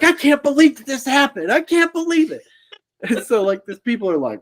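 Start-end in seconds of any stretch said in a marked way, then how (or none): 0.0-2.4 much higher, mock-shocked bystander; imitating a stunned onlooker, pitched way up; stunned disbelief. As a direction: squeak out the disbelief.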